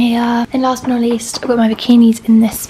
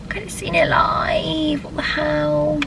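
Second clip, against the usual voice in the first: disappointed; 4 semitones higher, 5 dB quieter, slower than usual. Real, not acted. Missed the gig, lamenting.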